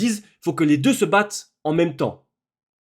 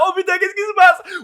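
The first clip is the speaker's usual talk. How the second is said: much higher, louder astonished cry; hyped-up shock at the screen; excited disbelief; 4 dB louder than usual. Retold or imitated. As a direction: gasp it out as if watching live. Reliving the climax of the film.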